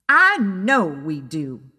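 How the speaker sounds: understated exasperated outburst; snapping. Acted. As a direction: snap it back, fed up. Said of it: "I screamed".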